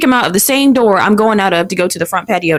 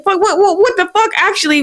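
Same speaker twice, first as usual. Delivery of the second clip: upset outburst; 9 semitones higher than usual. Retold or imitated. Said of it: "I was really upset".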